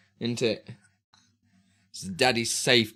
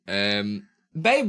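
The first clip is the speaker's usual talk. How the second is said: ordinary talk, nothing marked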